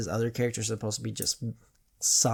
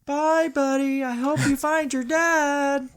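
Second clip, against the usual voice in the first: narwhal voice; 5 dB louder, slower than usual. Retold or imitated. Quoting the narwhal from Elf.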